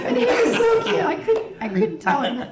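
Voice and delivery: high, loud, laughing telling; can barely get the words out for laughing; laughing through words. Real, not acted. Actress tells fans about the misspelled sign while laughing hard.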